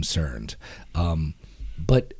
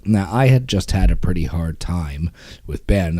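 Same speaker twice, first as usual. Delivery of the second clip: ordinary delivery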